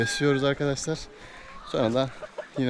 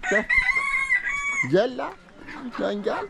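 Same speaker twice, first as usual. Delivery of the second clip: coaxing call; slower than usual. Real, not acted. Calling goat to come.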